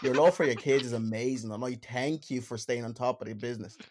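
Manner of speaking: put-on hammy Dublin accent reading. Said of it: "let me read them out in Dublin accent".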